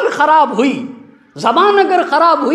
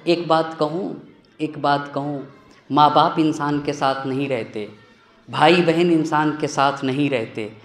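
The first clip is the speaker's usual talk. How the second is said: quiet confiding; 9 semitones lower, 7 dB quieter than usual. Real, not acted. Intimate confiding conclusion.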